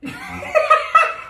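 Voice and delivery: shrill cackling laugh; high cackle. Real, not acted. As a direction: burst into a squeaky cackle. Laughing at the cake.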